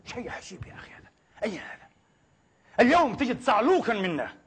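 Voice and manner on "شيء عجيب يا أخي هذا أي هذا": hushed, whispered amazement; awed whisper. Real, not acted. Preacher whispering his amazement at the story to the crowd. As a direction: drop to a whisper in wonder.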